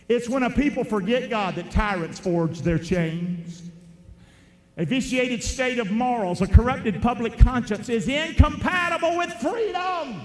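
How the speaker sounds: normal talking voice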